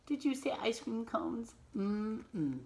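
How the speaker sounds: plain reading voice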